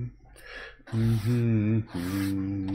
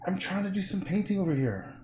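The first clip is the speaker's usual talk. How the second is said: higher, softer whine to the dog; complaining to dog; 8 semitones higher, 4 dB quieter than usual. Real, not acted. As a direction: plead that you're busy.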